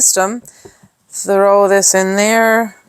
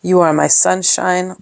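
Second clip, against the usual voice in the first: normal talking voice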